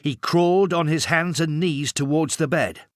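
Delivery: plain reading voice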